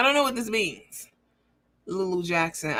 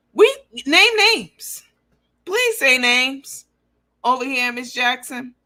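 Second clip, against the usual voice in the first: higher, louder and slow, taunting; challenging; 5 semitones higher, 6 dB louder, slower than usual. Real, not acted. Daring the rival to name names, taunting. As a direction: dare her to say it.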